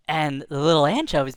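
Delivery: ordinary delivery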